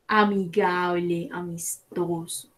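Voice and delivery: ordinary talk, nothing marked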